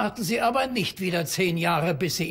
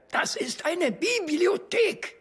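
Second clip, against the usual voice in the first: slow, high, stern reminder; stern scolding; 10 semitones higher than usual. Acted. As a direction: scold them slowly. Librarian scolding in library.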